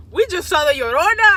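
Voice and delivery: loud, high-pitched retold outburst; imitating a panicked cousin, loud and high; alarmed announcement. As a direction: blurt it out like breaking scary news.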